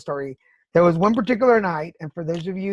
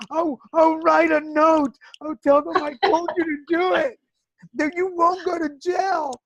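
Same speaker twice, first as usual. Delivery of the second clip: desperate high plea; 10 semitones higher than usual. Retold or imitated. To her husband, planning a note, high pleading voice. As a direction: plead with wild logic, near tears.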